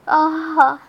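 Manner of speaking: loud acted moan. Narrator voices her own pleasure.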